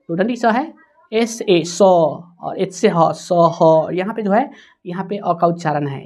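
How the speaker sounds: ordinary talk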